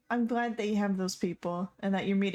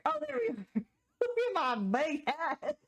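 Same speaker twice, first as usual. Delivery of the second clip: delighted squeal; 8 semitones higher, faster than usual. Real, not acted. Delighted at finding a big hat.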